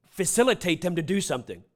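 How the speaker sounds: usual speaking voice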